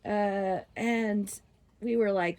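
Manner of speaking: ordinary talk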